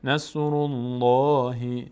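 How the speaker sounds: الله with a full thick l; نصر الله, full lam; heavy Allah. Shown as a demonstration.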